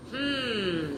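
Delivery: long high, soft "hmm"; pondering hum. A narrator's reading. Zachary suddenly getting an idea, pondering hum. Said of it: "said Zachary suddenly. He had an idea".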